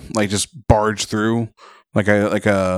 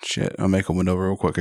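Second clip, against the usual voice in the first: ordinary talk, nothing marked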